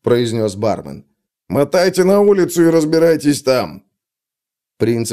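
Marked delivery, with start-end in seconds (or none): 1.5-3.8 barman's order. A narrator's reading. Barman throws them out.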